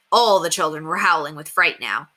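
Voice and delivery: ordinary delivery, nothing marked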